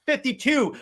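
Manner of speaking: normal talking voice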